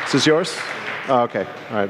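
normal talking voice

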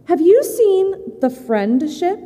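high, slow, eager asking; hopeful hedgehog question. Acted. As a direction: ask like a small animal full of hope. Hedgehog asks rat hopefully.

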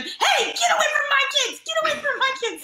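high, loud protective yell at pickpockets; retold yell. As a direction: shout the kids away like a guardian. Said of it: "start yelling at them".

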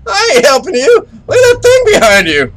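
very high, loud squeaky panicked ghost. Retold or imitated. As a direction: squeal it like a scared little ghost. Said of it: "The ghost's like".

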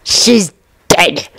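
lightly voiced menacing werewolf; Greyback. A narrator's reading. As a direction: savour it with bared teeth. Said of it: "Greyback said and bared his teeth".

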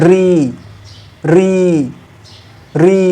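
রি said as র sliding into ই, repeated as a sample; teacher demonstrating the disputed রি, ending in ই; the common রি. Shown as a demonstration. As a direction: sound out রি plainly, ending on an ই vowel. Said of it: "যখন আমরা রি উচ্চারণ করছি".

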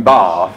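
BATH as low, loud "bahth"; long vowel "bath". Retold or imitated. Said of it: "duck going".

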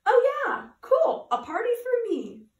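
high, loud burst of glee; light up high and loud; delighted realization. A narrator's reading. Dory delighted by her party.

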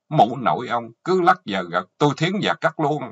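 loud, furious final threat; angry cursing. Acted. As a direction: curse him and threaten loudly. Wife curses husband, final threat. (chửi rủa đe dọa, giọng to)

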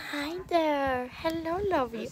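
soft, high pet-talk greeting; cooing to a cat. Real, not acted. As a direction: coo gently to a kitty.

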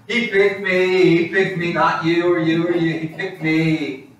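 mock boasting. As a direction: crow to the whole village, hammed-up. Acts Mary boasting on victory lap.

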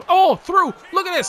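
sudden high, loud burst of excitement; excited outburst. Real, not acted. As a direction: jump up at the chance. Excited reaction to a play.

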